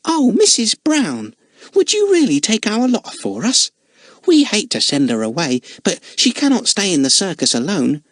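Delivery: put-on a grateful, pleading girl's voice; hopeful Lal. A narrator's reading. Lal begging Missus Brown to take Lotta, high and eager.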